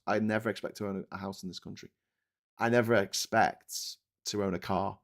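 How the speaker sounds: usual speaking voice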